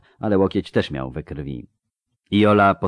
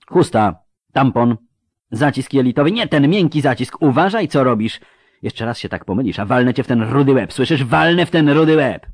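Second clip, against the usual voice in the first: loud, raised orders turning to threat; angry scolding; 7 semitones higher, 8 dB louder than usual. Acted. Surgeon threatening a clumsy assistant, angry scolding. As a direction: scold a bungling helper mid-operation.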